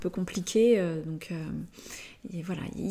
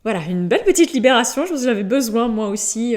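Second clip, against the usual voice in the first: laughing; 4 semitones higher, 8 dB louder, faster than usual. Real, not acted. Laughing release after hiccups.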